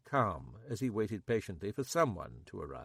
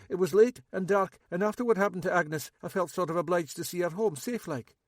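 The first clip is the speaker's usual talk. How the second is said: higher, louder nervous local man; Max; 9 semitones higher, 6 dB louder than usual. A narrator's reading. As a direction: play Max high and jittery. Max voiced.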